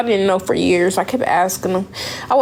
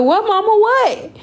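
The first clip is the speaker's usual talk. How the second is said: high, alarmed "What, mama?"; panicked question to her mother, pitch jumping up; alarmed plea; 12 semitones higher, slower than usual. Retold or imitated. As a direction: daughter begging mom for the news. Mother's face changes, she panics.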